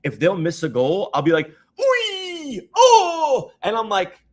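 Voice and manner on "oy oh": high, loud "Oy! Oh!" cheer; foreign-style cheer. Retold or imitated. Showing friends how he cheers at the World Cup, high and loud. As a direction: cry out like a fan at a miss. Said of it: "why do I sound like that".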